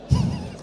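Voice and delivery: quick high-pitched chuckle; a sheepish high giggle; high laugh. Real, not acted. Laughing at calling boss stupid.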